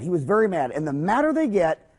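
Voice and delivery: ordinary talk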